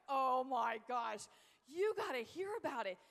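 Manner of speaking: emphatic, hammed-up sales excitement; retold amazed enthusiasm. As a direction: stretch each word in big mock astonishment. Retold excited pitch to stranger.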